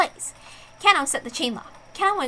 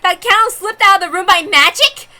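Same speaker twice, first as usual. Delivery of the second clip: slow, loud disbelieving cry, pitched up; shocked outburst; 6 semitones higher, 8 dB louder, slower than usual. Acted. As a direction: recoil in outrage at the idea. Detective shocked by magic claim.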